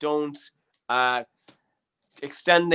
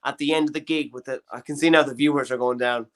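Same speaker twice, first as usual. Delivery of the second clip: usual speaking voice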